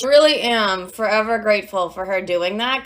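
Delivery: ordinary talk, nothing marked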